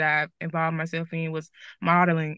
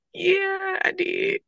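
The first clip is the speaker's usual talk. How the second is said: high, sassy yeah I did; high mock reply; 12 semitones higher, much slower than usual. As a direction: squeak it out cheekily. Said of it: "I was like".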